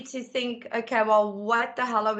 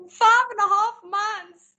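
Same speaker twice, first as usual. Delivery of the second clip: blurted confession; 12 semitones higher, 7 dB louder than usual. Retold or imitated. Teen daughter finally admits to mum how far along she is, blurted higher and louder.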